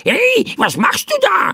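very high, creaky crow squawk; squawking startled bird. A narrator's reading. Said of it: "rief der Vogel".

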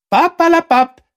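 extrem hoch, laut abgetan; abwinkende Feldmaus. A narrator's reading. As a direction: Unsinn wegwischen, quietschend. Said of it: "sagte die Feldmaus". (quietschend hohes Abwinken)

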